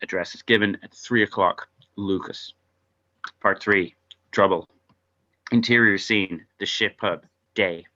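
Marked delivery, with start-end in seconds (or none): none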